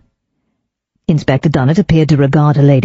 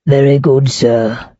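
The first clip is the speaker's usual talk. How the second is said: gloomy; 3 semitones lower, slower than usual. Acted. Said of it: "with his usual gloom".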